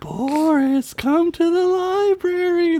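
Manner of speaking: high, slow, eerie spirit voice; haunting call from the dark; ghostly voice. Acted. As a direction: beckon him like a disembodied spirit. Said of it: "the voice said".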